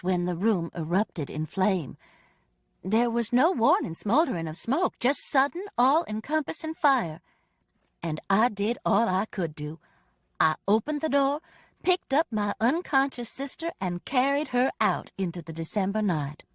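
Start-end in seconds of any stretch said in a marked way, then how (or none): none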